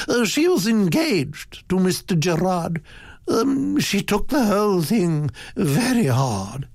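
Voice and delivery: high, hesitant society lady; high voice, explaining with ums and uhs; Duchess character voice. A narrator's reading. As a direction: answer as a duchess, high-pitched with little hesitations.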